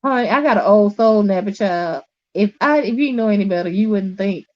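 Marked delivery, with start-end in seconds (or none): none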